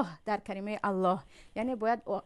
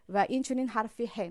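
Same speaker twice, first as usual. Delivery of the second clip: ordinary delivery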